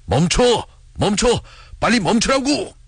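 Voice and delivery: urgent high-pitched cry to stop; sudden shout. A narrator's reading. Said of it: "돌연 큰 소리로 외쳤다". (돌연 큰 소리로 높게 외치듯)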